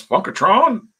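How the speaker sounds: high, loud holler; re-enacted fan yell, loud and high. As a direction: yell it out high, like hailing someone at a show. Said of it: "I'm the guy that yells".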